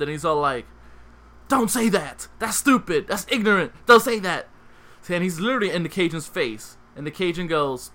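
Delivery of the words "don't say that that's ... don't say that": higher, angry shouting; offended yelling. Retold or imitated. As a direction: yell it at him, offended. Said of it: "he gets offended".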